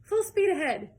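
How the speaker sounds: high, loud rallying cry; excited shout. Acted. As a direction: yell it waving a stick. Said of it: "jumping up and waving his stick".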